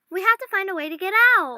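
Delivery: high and loud, insistent; rally your friend to act; urgent declaration. Acted. Elizabeth urges Matt to escape, loud and insistent. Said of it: "Elizabeth stated".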